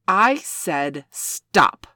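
furious slow scolding; angry slow command. Acted. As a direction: lay down the law, word by word. Pushing away the rough puppy.

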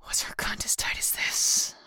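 whispered, hissing challenge; sharp hissing whisper; hissed. A narrator's reading. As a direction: hiss it without voice. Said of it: "I hissed".

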